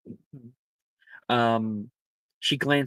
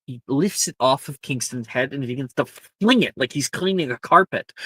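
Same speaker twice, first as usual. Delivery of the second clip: ordinary talk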